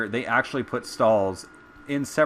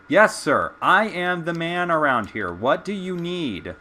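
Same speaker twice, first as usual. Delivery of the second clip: sarcastic yes sir; 6 semitones higher, 4 dB louder than usual. Retold or imitated. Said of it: "I just said".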